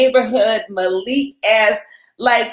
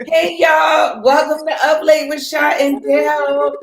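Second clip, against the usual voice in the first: hype show opener; 4 semitones higher than usual. Acted. Hosts opening their late show.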